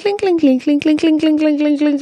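high, tapping clinks in a quick row; imitated clinking spoon. A stirred teacup clinking beside the speaker, in high tapping clinks. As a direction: mimic a teaspoon clinking on a cup. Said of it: "that stirring goes clink".

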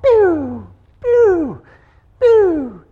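high, loud pew pew of a Roman candle; firework pew sounds. Retold or imitated. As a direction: spit out each shot like a firework. Mimicking Roman candle shots.

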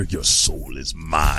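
slow, menacing villain line; Shang Tsung voice. Retold or imitated. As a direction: drag out the threat slowly.

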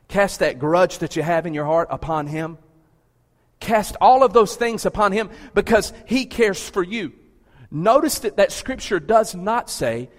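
loud sermon climax urging them to let go; preaching at full force. Acted. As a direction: drive it home to the congregation.

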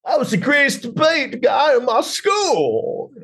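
high, slow, hammed-up Southern accent; Kirk Cameron, bad Southern accent. Oklahoma student boasting about debate, exaggerated Southern accent. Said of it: "That's my impression of it".

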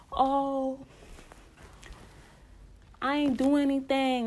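Shorty's innocent reply. Retold or imitated. Shorty voiced in told story.

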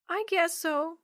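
put-on very high, glum little kid; high-pitched downcast child voice. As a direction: give in reluctantly as a small child. Unhappy Yo-Yo reluctantly agrees.

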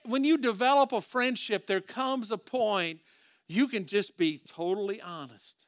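usual speaking voice

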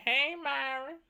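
lightly voiced girl greeting, lifted and sing-song; a hint of a sweet girlish hey.

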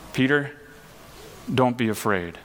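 softly, gently reassuring Peter; tender reassurance. Retold or imitated. Jesus comforting scared Peter, quiet and tender. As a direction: soothe a frightened friend, low and kind.

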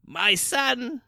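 high-pitched, frail mother's voice; high voice of an ailing parent. A narrator's reading. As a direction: play the sick mother calling her son. Ailing blind old mother speaking.

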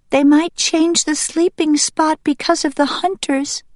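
put-on high, anxious child-character voice; young duck Green Top, worried. Young duck may not see mother for long, worried. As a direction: explain the worry in a high young voice.

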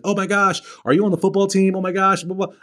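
high-pitched mock excitement; gushy crowd impression, high and eager; gushing classmates. Excited classmates at orientation, acted out.

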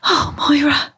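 high, breathless wheeze; wheezing. A narrator's reading. Shona sags on Moira's doorstep, wheezing. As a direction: gasp the name weakly. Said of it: "she wheezed".

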